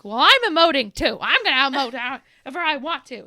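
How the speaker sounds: loud, high mock tantrum; petulant outburst. Mother snapping back at her children, loud mock tantrum. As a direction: shout back like a sulky kid, hammed up. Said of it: "me in my small brain moment".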